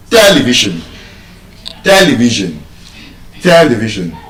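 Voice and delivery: television stressed on the first syllable, TEL; correct pronunciation. Shown as a demonstration. Teacher gives the dictionary version, first-syllable stress. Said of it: "it is stressed on the first syllable".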